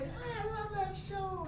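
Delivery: very high, slow, stretched-out gushing; Con Ed woman. Retold or imitated. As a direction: imitate a gushing fan in falsetto. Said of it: "this woman who works for Con Ed".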